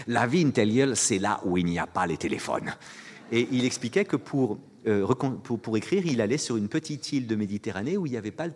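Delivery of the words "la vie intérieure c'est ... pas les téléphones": grand offhand verdict. Retold or imitated. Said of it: "superbe, laisse tomber".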